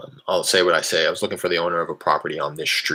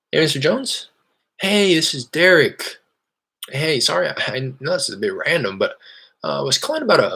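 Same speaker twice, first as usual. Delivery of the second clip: bright, higher salesman voice on a cold call; cold-call voice, higher and eager; upbeat phone pitch; 8 semitones higher than usual. Real, not acted. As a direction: sound friendly and casual to a stranger.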